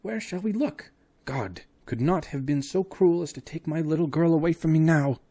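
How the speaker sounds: put-on high, anguished old man's plea; grief-stricken professor's cry; distraught old father. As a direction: beg heaven to spare his girl. Father fearing for his daughter.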